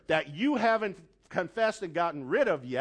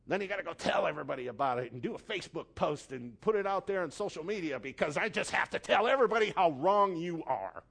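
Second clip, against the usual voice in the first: ordinary talk, nothing marked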